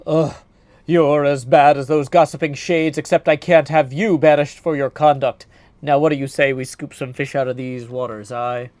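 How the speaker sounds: loud stammering warrior voice; flustered Odysseus. Acted. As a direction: caught off guard, fumbling for words loudly. Odysseus exasperated.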